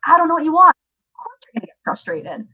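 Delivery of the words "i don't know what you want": higher, louder helpless frustration; exasperated mom. Retold or imitated. Retelling a frustrated mother facing her nonverbal son, pitched up. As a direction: throw up your hands as a baffled mother. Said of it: "And his mom's like".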